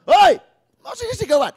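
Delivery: sudden high, very loud retort; angry yell back. Acted. Preacher acting out snapping back at someone who shouted, angry high yell. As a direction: explode in an acted shout, pitch leaping way up. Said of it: "may sumigaw sa'yo. Anong gagawin mo agad".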